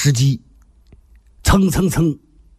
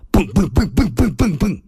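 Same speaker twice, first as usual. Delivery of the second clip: racing heartbeat sound; 6 semitones higher than usual. Acted. Imitating wildly racing heartbeats.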